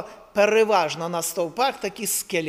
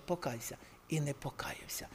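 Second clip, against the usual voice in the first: dropped to a near whisper; hushed; 6 semitones lower, 16 dB quieter than usual. Acted. Preacher telling of the son who would not repent, hushed. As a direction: let the words fade almost to breath. Parents' last warning, then refusal.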